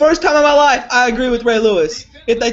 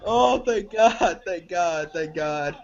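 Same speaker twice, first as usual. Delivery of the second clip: relieved sigh; 6 semitones lower, 7 dB quieter, much slower than usual. Real, not acted. Relieved after the pick.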